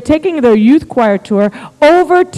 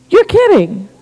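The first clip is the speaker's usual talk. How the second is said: higher-pitched astonished reaction; disbelief; 7 semitones higher, slower than usual. Retold or imitated. Husband learning God already told wife, disbelief. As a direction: react as if stunned, acted out.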